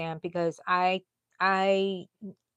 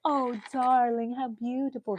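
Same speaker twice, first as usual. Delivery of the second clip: mock gushing praise; 5 semitones higher than usual. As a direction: coo over it with exaggerated admiration. Mock praise the aunt never gave.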